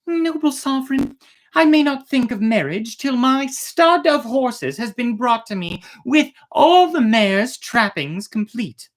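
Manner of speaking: high, lighter voice of a polite princess; princess Ileana pleading. Acted. Princess addresses the emperor.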